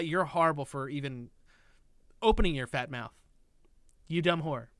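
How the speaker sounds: mock nasty insult. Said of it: "make things sound like".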